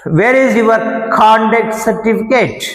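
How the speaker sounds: conduct said CONduct, first syllable hit; slow demo, punch CON in conduct; stress on CONduct. Shown as a demonstration. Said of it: "conduct అని కా మీద stress చేశా". (conduct లో CON మీద ఒత్తి, నెమ్మదిగా)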